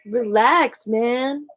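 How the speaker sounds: lightly voiced careless "Relax, man"; a hint of brushing someone off; mock dismissive relax. Host imitating people who brush you off, mildly careless. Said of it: "some people who just say".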